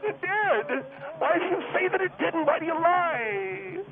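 high, drawn-out anguished whine; wailing protest. Acted. Guest denying to the host that it failed, wailing. Wailing denial at host's verdict.

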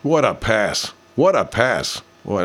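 usual speaking voice

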